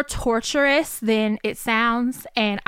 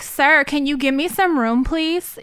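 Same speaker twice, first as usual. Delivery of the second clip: polite request; 5 semitones higher, 5 dB louder than usual. Retold or imitated. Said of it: "She was polite".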